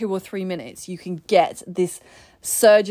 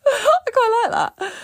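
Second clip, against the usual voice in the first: laughing; 13 semitones higher, 3 dB louder than usual. Real, not acted. Laughing at June's saying.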